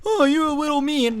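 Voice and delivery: high, prissy mock of a commenter. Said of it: "people are like".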